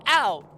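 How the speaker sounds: sudden loud, high "ow"; pained yelp. Real, not acted. Player reacts to bonking her head, pained yelp. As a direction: yelp as if bumped.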